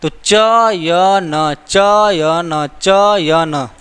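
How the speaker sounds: चयन drawn out very slowly, syllable by syllable, letting the अय sound stand out; slow pronunciation demo. Shown as a demonstration. Teacher sounding out चयन slowly for students, stretched syllables. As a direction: stretch चयन so the अय in च-य is clearly heard. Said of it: "चयन शब्द का उच्चारण करिए".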